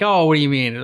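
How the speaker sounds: ordinary talk, nothing marked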